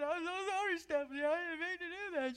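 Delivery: high, loud tearful apology; crying apology. Retold or imitated. Tearful reconciliation in church aisle.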